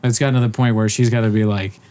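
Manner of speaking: usual speaking voice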